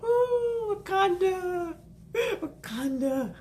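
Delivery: high, slow, teary crooning of the name; swooning. Real, not acted. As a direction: swoon over the name Wakanda. Swooning over the film.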